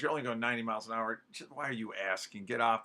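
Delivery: low, quick, softened brush-off; dismissive controller. Acted. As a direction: brush the pilot off under your breath. Imagined controller brushing off slow pilot.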